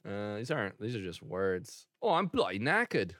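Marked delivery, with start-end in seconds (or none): 2.0-3.1 lightly put-on bloke voice; weary London geezer, played lightly; mock British accent. Joking about the wife in a light British accent. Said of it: "you found what I found".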